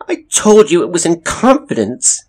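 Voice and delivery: high, loud, upset reproach; loud, wounded outburst on the verge of tears; near tears. A narrator's reading. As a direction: accuse a friend, close to crying. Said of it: "Eleanor Watkins was near tears".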